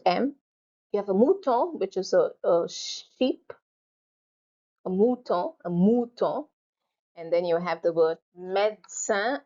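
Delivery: usual speaking voice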